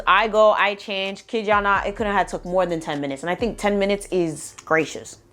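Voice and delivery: ordinary talk, nothing marked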